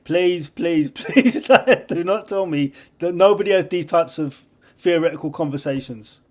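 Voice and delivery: high, loud, laughing pleading. Real, not acted. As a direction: beg them to say it's true. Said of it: "I'm completely crazy".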